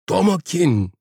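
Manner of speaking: high-pitched, emotional cry of a name; anguished call. A narrator's reading. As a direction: call out to a lost lover. Linda cries out to him. (acıyla, tiz sesle seslenerek)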